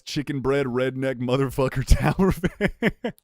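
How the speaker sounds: loud, laughing repeat of an absurd intro; incredulous quoting. Retold or imitated. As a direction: crack up while quoting it loudly. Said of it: "What the fuck was that intro?".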